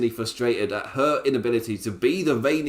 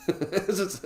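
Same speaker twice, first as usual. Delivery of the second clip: laughing; 8 semitones higher, 4 dB quieter than usual. Real, not acted. Laughing at own impression.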